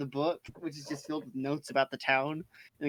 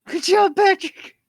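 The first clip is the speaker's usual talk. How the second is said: very high, loud, drawn-out squeal; mock cheer; 13 dB louder, slower than usual. Players jokingly praise Patrick for breaking it, mock cheer.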